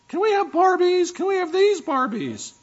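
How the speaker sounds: put-on very high, loud little girl pleading; child begging Mom, high and loud. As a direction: whine for the Barbies like a kid. Child begging mother at auction.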